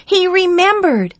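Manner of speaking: high, loud thrilled outburst; can't wait to tell mom; excited news. Acted. Boy excitedly tells mom news.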